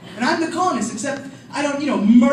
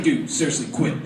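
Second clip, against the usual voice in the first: much lower mock scolding of brother; lowered hushed warning; 11 semitones lower, 5 dB quieter than usual. Older sibling to brother, lower hushed scolding. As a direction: tell your brother to stop, low. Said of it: "I was like".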